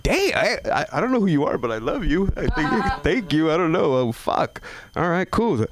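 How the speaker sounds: high, giddy thank-you; flattered delight. Retold or imitated. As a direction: gush at the stranger. Flattered reply to heckler lady.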